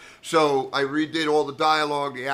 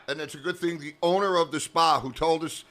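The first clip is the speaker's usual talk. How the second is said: normal talking voice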